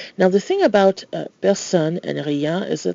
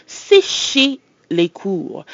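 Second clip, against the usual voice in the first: slow demo; 3 semitones higher, much slower than usual. Shown as a demonstration. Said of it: "or we can".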